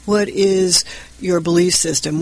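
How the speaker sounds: ordinary delivery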